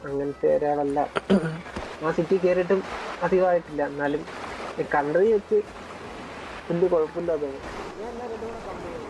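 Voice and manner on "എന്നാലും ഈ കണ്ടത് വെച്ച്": usual speaking voice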